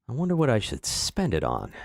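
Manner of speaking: ordinary delivery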